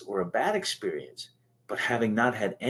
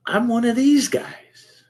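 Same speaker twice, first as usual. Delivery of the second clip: much higher and louder, slow, like a horrified realization; mock dismay; 13 semitones higher, 6 dB louder, slower than usual. Recalling standing up at his first AA meeting, mock horrified. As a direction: stretch it out in startled dismay. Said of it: "And I went".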